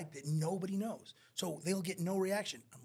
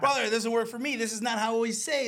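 loud, high-pitched wrestler objecting; mock a wrestler arguing with the director; protesting wrestler; 8 semitones higher, 10 dB louder than usual. Wrestler on a movie set refusing direction, loudly protesting. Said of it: "That's how wrestlers are".

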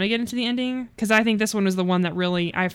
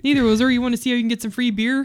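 mock Canadian accent; 4 semitones higher, 5 dB louder than usual.

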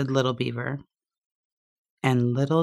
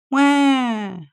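high loud wail; crying wah; 10 semitones higher, 5 dB louder than usual. A narrator's reading. Lonely beaver wailing on the pond. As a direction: let out a child's wail.

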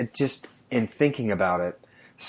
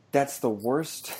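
ordinary talk

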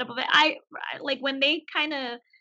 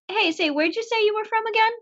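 suspicious question; 9 semitones higher, 4 dB louder than usual. Retold or imitated. Imitating Sheldon, suddenly suspicious.

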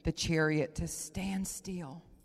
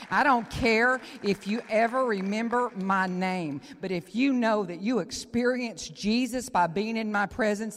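preaching at full force; 10 dB louder than usual. Acted. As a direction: proclaim it to the whole room.

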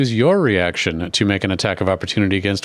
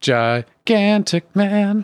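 slow, very high silly voice; high drawn-out voice; 12 semitones higher, much slower than usual. Acted. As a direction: stretch it out up high. Echoing joke, high slow.

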